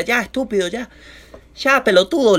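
high, exasperated mock telling-off; scolding friend. Girl's friend telling off the drunk guy, mock scolding. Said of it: "la amiga le decía".

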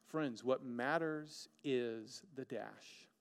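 slow weighty statement; slow emphasis. Real, not acted. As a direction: let each word sit. Sermon key line slowed.

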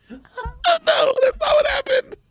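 ordinary talk, nothing marked

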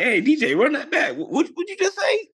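high, rising disbelief; mock incredulous. Man questioning the radio DJ about a line, mock incredulous.

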